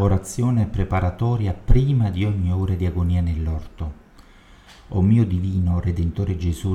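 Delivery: loud, devout prayer read out; loud devotional prayer reading; fervent prayer. Acted. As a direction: pray it aloud to Jesus, full voice. Reads a preparatory prayer aloud.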